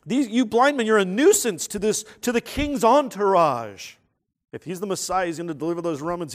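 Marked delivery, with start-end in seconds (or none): 0.0-4.0 loud, raised acted complaint; indignant public rebuke, louder; scolding crowd. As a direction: tell off a nuisance indignantly. Acting out the callous crowd scolding beggars.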